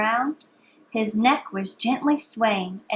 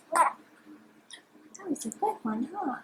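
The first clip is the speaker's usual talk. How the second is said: slow tender; 5 semitones higher, 8 dB quieter, slower than usual. Real, not acted. Mom after reading to child.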